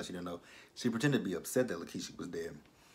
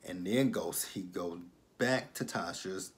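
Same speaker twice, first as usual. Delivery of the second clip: normal talking voice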